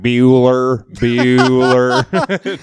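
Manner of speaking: a hint of the droning Bueller roll call. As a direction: call the name like a bored teacher. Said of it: "a mosaic of eighties movies".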